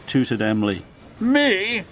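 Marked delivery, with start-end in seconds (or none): 1.2-1.8 high, incredulous snort. A narrator's reading. Gordon is asked to help Emily, snorting scornfully. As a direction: throw it back as a high, scornful question. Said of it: "snorted Gordon".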